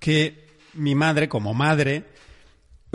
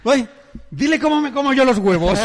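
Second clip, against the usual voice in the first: higher, louder call to dad; mother calling across the table to dad, loud and higher; 10 semitones higher, 6 dB louder than usual. Retold or imitated. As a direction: loudly ask dad to back her up. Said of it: "le dice".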